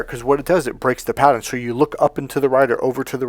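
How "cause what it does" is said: normal talking voice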